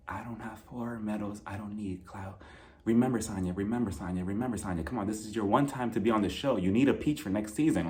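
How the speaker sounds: very soft, low murmur to herself; muttered self-pep talk. Shown as a demonstration. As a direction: coach yourself under your breath. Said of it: "I rehearsed my lines for this fight".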